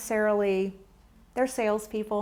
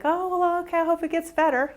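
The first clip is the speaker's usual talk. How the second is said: higher, quick brush-off "Oh, well, okay"; dismissive brush-off; 8 semitones higher, 4 dB louder than usual. Retold or imitated. Speaker mocking a dismissive friend's quick brush-off. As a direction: toss it off breezily, not really listening. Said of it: "I'm not just gonna be like".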